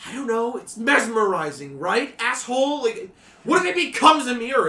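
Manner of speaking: put-on anxious teen shout. GM voices scared teen.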